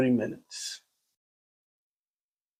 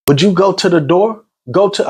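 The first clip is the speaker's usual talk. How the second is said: higher-pitched, exasperated demand; retold yelling; 7 semitones higher than usual. Caller urging him to answer the door, mild retold yell. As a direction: re-enact your own yell without full volume. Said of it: "I'm yelling".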